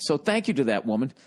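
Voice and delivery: usual speaking voice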